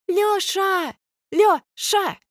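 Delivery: high shrill yell across arena. A narrator's reading. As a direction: call out loud through cupped hands. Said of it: "вопит Алька в сомкнутые в рупор руки".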